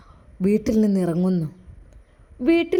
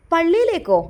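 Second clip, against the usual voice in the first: higher, louder shocked question; disbelieving cry; 7 semitones higher, 6 dB louder than usual. Acted. Mother hearing her son is off to the mosque, loud disbelief. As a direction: shoot the word back in disbelief.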